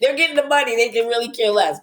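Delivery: high laughing reply. Real, not acted. Laughing at host's joke.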